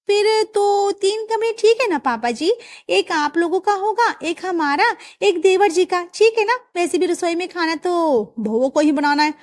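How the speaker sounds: high, sweet daughter-in-law asking a favour; young daughter-in-law. Acted. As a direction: coax the father-in-law for a bigger kitchen. Daughter-in-law settling the rooms.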